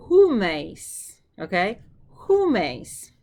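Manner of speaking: Humes called out loud, with a falling tune; emphatic drill. Shown as a demonstration. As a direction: belt out Humes for the class. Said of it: "look at this one".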